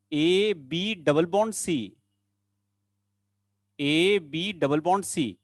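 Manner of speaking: slow, spaced-out dictation of each part; slow spelling-out. Real, not acted. Teacher spelling out a bond pattern for students, slow and spaced-out. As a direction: spell it out slowly, stretching each piece.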